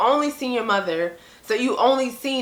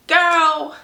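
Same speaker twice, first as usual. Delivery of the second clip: put-on sassy girl; 7 semitones higher, 5 dB louder than usual. Sassy mock exclamation.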